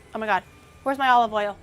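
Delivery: usual speaking voice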